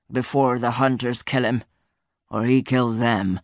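low, grave royal voice; stern king. Acted. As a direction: warn like a solemn king. King gives orders.

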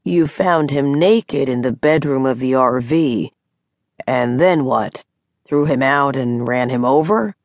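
lightly voiced man, measured questioning; deep baritone. A narrator's reading. Doctor questioning Mary's odd account, hint of baritone. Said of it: "his voice a deep baritone".

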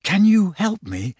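high, eager request; hopeful. A narrator's reading. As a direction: ask hopefully, lifting the pitch. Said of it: "I asked hopefully".

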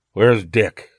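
grunted. A narrator's reading. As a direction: grunt it out of a battered body. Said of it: "and grunted".

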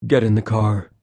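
a lightly voiced deep, absolute command; a hint of a seductive command; resonant seductive command. A narrator's reading. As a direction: speak as if sure to be obeyed. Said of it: "a resonant, seductive command".

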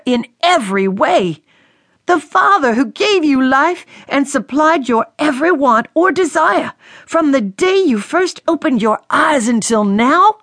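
a furious captain berating his daughter; angry father. A narrator's reading. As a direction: lash out at her, biting. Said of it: "His shouts of anger crippled her".

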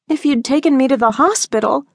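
put-on pleading teen correcting her mother; teen daughter, protesting. A narrator's reading. Daughter Jordan voiced by narrator.

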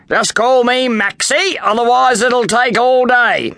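high, slow impatient voice; exasperated trainer. Acted. Trainer tells the class to shorten his name, impatient. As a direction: cut them short wearily.

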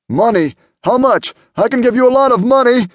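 high, loud, desperate bargaining; frantic high-pitched pleading; tearful sputtering plea. A narrator's reading. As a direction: beg for your life with an offer. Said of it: "John sputtered, tears dripping".

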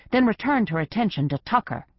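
ordinary delivery, nothing marked

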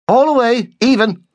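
high, loud call to the oars; called order. A narrator's reading. Midshipman calls to the rowers, loud. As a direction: shout across the boat.

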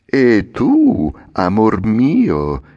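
a hint of the little man's honeyed coaxing, drawn out slowly; all compliments. A narrator's reading. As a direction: sweet-talk the puppet, slow and syrupy. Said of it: "volgendosi tutto complimentoso". (l'omino tutto complimentoso, mellifluo e lento)

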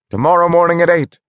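cried out. A narrator's reading. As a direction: call it out quickly to everyone. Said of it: "cried Mark".

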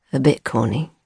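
low deadpan echo of her words; teasing low repeat; dry echo. Acted. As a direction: hand her phrase back flatly. He mocks her phrase back.

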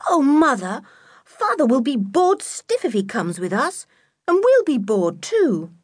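put-on high, protesting young girl; outspoken child. A narrator's reading. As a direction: complain to mother in a high voice. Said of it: "George was very outspoken".

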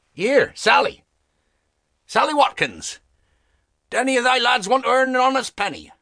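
high, fast call across the street. A narrator's reading. Father calling to Sally for a lad, high fast call. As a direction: hail Sally from a distance, pitched well up.

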